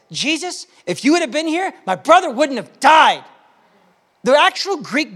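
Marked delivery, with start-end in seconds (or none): 0.1-3.2 frustrated. Retold or imitated. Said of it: "Mary said it like this".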